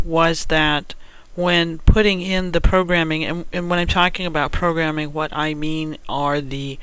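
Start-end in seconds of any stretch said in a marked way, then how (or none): none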